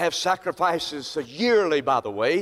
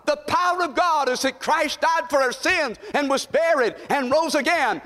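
preaching at full force; 11 semitones higher than usual. Acted. Pastor rousing his congregation about the cleansing blood, full-force preaching. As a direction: drive every line home to the pews.

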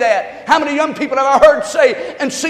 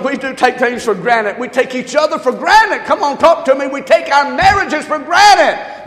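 ordinary talk, nothing marked

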